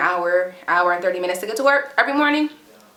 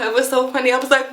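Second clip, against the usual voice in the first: normal talking voice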